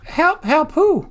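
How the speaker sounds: incredulous. Real, not acted. Incredulous at friend's claim.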